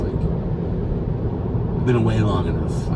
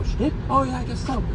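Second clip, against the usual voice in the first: surprised. Retold or imitated. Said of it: "I go".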